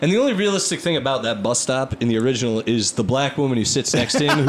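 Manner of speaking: normal talking voice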